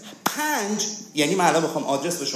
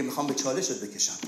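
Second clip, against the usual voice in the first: quiet taunt; 3 semitones lower, 7 dB quieter, faster than usual. Retold or imitated. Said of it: "اون مشکل تو میگه".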